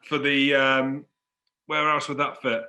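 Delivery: normal talking voice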